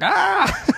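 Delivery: high mock yelp of pain; imitated scream. Fighter whose arm snapped in the gym, high mock yelp. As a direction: squeal like an arm just snapped. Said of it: "The guy's like".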